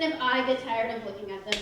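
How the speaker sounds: ordinary talk, nothing marked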